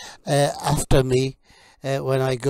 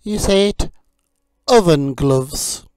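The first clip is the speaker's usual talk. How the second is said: vocabulary drill; 4 semitones higher, 6 dB louder than usual. Acted. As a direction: model each word for learners to repeat. Teacher drills kitchen vocabulary.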